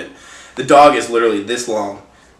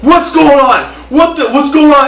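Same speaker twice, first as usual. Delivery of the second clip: higher, louder mock panicked shout; alarmed husband; 13 semitones higher, 6 dB louder than usual. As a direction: burst out asking what's wrong. Husband barreling out confused.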